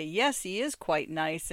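ordinary delivery

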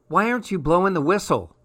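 put-on fast, impatient child's question; a kid nagging Dad to start the race, impatient. A narrator's reading. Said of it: "I asked Dad impatiently".

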